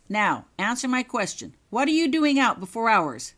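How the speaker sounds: loud, high stern demand. Acted. Prefect demands answer.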